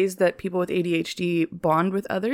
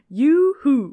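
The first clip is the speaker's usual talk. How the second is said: loud high cowboy yoo-hoo; cowboy yoo-hoo call; 7 semitones higher, 5 dB louder than usual. Pretend. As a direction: holler it like a cowboy.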